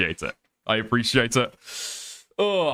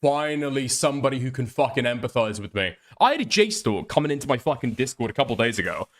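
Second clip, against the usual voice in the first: ordinary delivery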